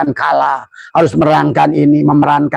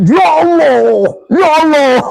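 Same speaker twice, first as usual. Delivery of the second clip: high, loud pleading call to Allah; a loud, pitched-up plea; desperate cry to God; 13 semitones higher, 3 dB louder than usual. Acted. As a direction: cry out to God like a drowning man. Anguished cry to God in prayer.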